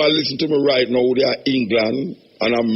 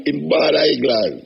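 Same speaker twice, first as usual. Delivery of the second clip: ordinary talk, nothing marked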